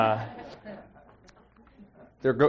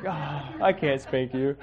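tender give-in; slower than usual. Retold or imitated. Father melting at his daughter, slow and tender. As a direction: drawl it out fondly, slowly. Dad softening to cute daughter.

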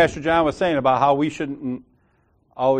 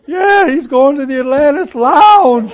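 high, loud tattling; mock scandalized; 11 semitones higher, 8 dB louder than usual. Churchgoer telling pastor of lounge visits, mock scandalized. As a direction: imitate a gossip breaking shocking news.

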